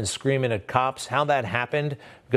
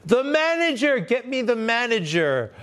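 high, whiny entitled customer; 9 semitones higher than usual. Retold or imitated. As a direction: sneer it like a demanding Karen. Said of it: "They all wanna talk to the manager".

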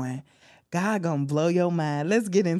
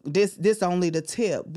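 ordinary talk, nothing marked

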